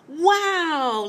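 excited high shout of wow. A narrator's reading. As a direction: call out wow in delight. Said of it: "Ladybug shouted".

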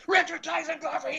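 high, strained mock yell; imitated yelling. Studio man chasing the intruder, high mock yelling. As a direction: mimic an enraged man yelling a name, without full volume. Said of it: "He's yelling".

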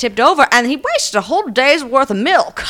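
high, loud, indignant lament; Jimmy Stewart. Acted. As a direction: let it slip into an exasperated old-movie impression. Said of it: "Jimmy Stewart came out there".

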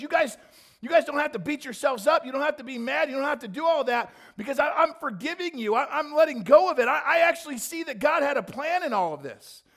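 high, loud, urgent forgiveness; passionate Joseph. Acted. Joseph begs brothers not to punish themselves, high and loud. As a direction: plead with them to let go of guilt.

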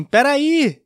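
high, drawn-out yell to stop someone; retold shout. Acquaintance yells after him to wait, retold shout. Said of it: "ele gritou assim".

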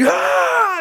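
very high, loud shriek; retold terrified scream. Startled son as dad walks in, screaming. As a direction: scream as if jumping out of your skin. Terrified jump off the couch, acted.

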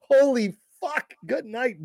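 slow, high-pitched awe; stunned, stretched-out exclamation; retold amazement. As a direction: draw it out in astonishment. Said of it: "I go".

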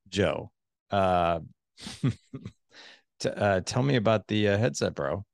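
mock the headset. Shown as a demonstration. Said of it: "I used the exact same words".

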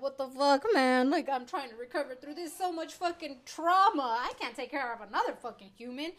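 loud, exasperated rant as a tired parent; mock shouted complaint of a worn-out parent; imitating exasperated people. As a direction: throw up your hands, overwhelmed. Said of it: "people are like".

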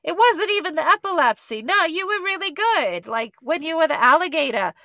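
put-on high, loud Jersey accent; New Jersey mom. Imitating Sam's mom urging her skater daughter, Jersey accent. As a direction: insist proudly as a hammed-up Jersey mom. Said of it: "they're in New Jersey".

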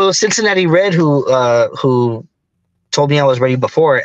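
normal talking voice

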